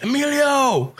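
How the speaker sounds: calling out high across a crowd; high shout of a name across the lot; yelled name. Retold or imitated. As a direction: yell his name to catch his eye. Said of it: "I yelled out".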